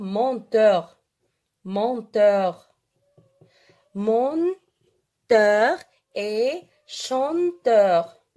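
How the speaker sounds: very slow, word by word with long pauses; slow dictation. Shown as a demonstration. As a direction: draw out each syllable as if for note-takers. Teacher dictates the two exceptions. (dictée très lente, mot par mot)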